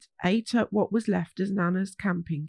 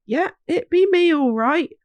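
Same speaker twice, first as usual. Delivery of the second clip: put-on hearty call from an old woodsman; lightly voiced friendly old hog; Horace Hogg voice; 7 semitones higher, 6 dB louder than usual. Horace answers the children.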